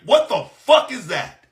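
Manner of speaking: ordinary talk, nothing marked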